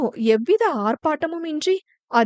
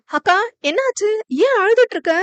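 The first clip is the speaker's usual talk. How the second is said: high-pitched, anxious questions; worried and agitated, much higher; alarmed concern; 7 semitones higher, 3 dB louder, faster than usual. A narrator's reading. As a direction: panic at a sister's tears, voice jumping up. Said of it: "என பதறிட".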